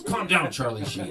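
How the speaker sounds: mock exasperated. Technician stopping the patient's rant, mock exasperated. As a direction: cut in sharply over the rant.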